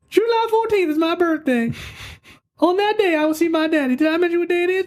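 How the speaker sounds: put-on high, giggly little-girl voice; excited little daughter. Dad mocking his daughter's excitement, high girlish voice. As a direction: play his daughter gushing about her birthday. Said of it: "That was good".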